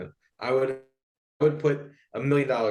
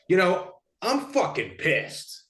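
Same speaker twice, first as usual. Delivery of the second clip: retold angry caller; 6 semitones higher, slower than usual. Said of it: "he's flipping out".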